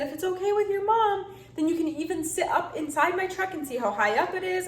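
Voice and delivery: lightly voiced generous trucker; kind trucker. Truck driver inviting the boy to sit up in his cab.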